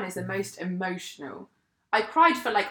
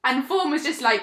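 normal talking voice

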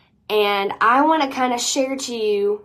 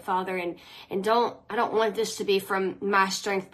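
prayer; 2 semitones lower, 4 dB quieter than usual. Acted. Opening prayer before broadcast.

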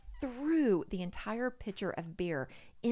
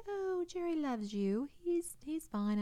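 high, drawn-out mock reassurance; slow, high-pitched retold soothing; reassuring girlfriend; 11 semitones higher, slower than usual. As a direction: voice a friend brushing off the worry. Voicing reassuring girlfriend in retold talk.

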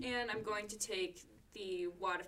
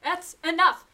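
much higher and louder order; commanding shout; 9 semitones higher, 15 dB louder than usual. Acted. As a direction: shout down the brawl. Detective stops the brawl.

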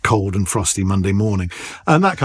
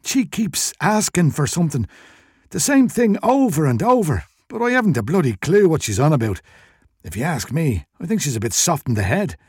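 higher-pitched, aggrieved Irish shopkeeper; wheezy shopkeeper complaining; burly Irish shopkeeper; 5 semitones higher than usual. A narrator's reading. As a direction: grumble as a put-upon Irish shop owner.

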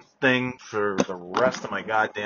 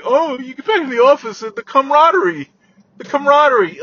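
mocking office cheer; 12 semitones higher, 6 dB louder, faster than usual.

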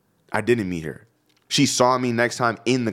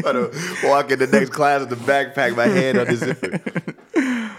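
laughing; 6 semitones higher than usual. Retold or imitated. Acting out storming off.

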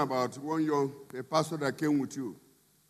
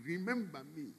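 fading plea; 11 dB quieter than usual. Acted. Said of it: "That is the cry".

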